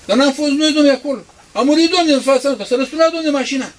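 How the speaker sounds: high, loud protesting; insistent witness. Acted. Preacher mimics people swearing they witnessed a crash. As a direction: insist loudly you saw it.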